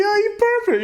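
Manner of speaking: very high taunting call; mock accusation. Imitating kids accusing each other of perping, high mock taunt. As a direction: taunt like kids calling someone out.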